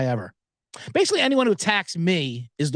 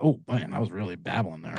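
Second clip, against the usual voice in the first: ordinary talk, nothing marked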